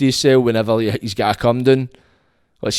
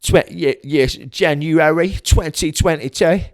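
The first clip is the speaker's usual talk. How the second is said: slow, drawn-out mock Glaswegian; hammed-up Glaswegian for the new year; 3 semitones higher, slower than usual. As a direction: lean into a mock Glaswegian, slowly. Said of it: "it sounded a bit Glaswegian there".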